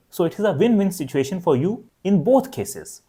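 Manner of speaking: normal talking voice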